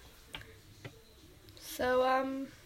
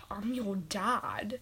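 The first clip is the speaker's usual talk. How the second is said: whisper; 4 semitones lower, 10 dB quieter, slower than usual. Acted. Dad character whispers reveal.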